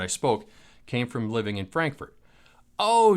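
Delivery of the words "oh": high, louder "Oh" of sudden understanding; a grandma's bright realising "Oh"; surprised oh. Retold or imitated. Old lady learns he lived in Frankfurt, surprised.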